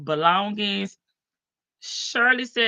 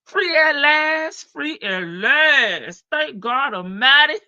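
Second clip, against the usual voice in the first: preacher proclaiming; 5 semitones higher, 5 dB louder, slower than usual. Acted. Celebratory preacher-style proclaiming.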